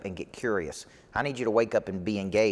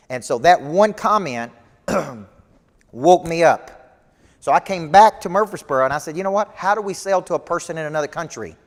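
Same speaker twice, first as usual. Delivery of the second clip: preaching; 7 semitones higher, 7 dB louder than usual. Acted. Coach speaks to live audience.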